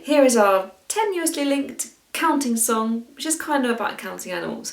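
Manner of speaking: ordinary delivery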